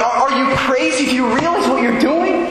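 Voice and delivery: high, loud exasperated outburst; imagined scream. Retold or imitated. Priest imagining yelling at a woman refusing grace, high loud outburst. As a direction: blurt it out in disbelief. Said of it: "I wanted to scream out".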